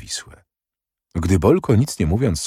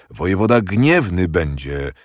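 usual speaking voice

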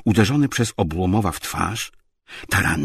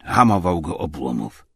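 plain reading voice

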